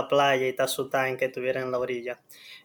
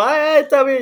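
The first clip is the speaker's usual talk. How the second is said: high-pitched soothing mom voice; much higher, put-on mom voice; imitated mother; 10 semitones higher, 4 dB louder than usual. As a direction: mimic a mother fussing over a hurt child. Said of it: "mi mamá llegó".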